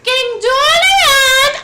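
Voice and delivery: tiny, very high, very loud squeak, slowly stretched; Mort impression. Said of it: "a Mort from Madagascar".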